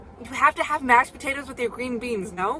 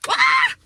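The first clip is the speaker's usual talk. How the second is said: excited squeal; 12 dB louder than usual. Retold or imitated. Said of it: "I'll text Jack and Grace and say".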